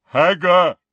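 booming giant call. Acted. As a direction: bellow it like a huge giant. Giant insists.

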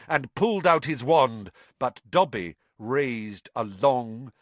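ordinary delivery, nothing marked

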